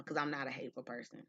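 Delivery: normal talking voice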